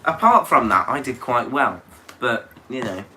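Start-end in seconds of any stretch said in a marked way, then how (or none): 2.2-3.0 put-on Belgian Poirot-style accent; a light Poirot Belgian lilt. Cohost says he sounds like Poirot, Belgian accent. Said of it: "You sound like Poirot".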